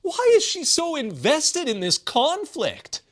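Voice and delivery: ordinary talk, nothing marked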